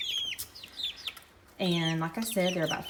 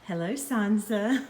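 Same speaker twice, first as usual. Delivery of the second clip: talking to a pet; 7 semitones higher than usual. Real, not acted. Talking to chicken high voice.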